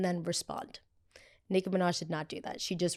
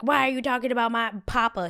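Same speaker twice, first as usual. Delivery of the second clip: higher mocking [name] voice; 5 semitones higher, 4 dB louder than usual. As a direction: sneer it like a defensive diva. Mocking [name] defending husband.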